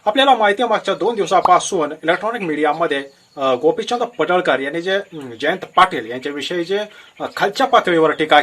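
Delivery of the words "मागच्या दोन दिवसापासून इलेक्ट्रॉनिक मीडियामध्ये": normal talking voice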